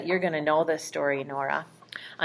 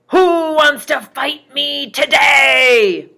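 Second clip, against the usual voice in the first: huge, slow, booming giant yell, much higher and louder; angry giant's shout; 8 semitones higher, 15 dB louder, slower than usual. Acted. Goliath challenges the frightened soldiers, booming slow shout. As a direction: bellow it like a giant daring the whole army. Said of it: "this very, very angry, loud voice".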